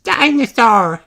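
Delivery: put-on high toddler word; little brother voice; George toddler voice. A narrator's reading. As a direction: blurt it like a tiny piglet. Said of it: "says George".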